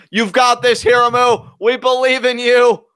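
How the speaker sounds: loud, high encouragement; rally him from the crowd; rallying cheer. Real, not acted. Cheering the wrestler on.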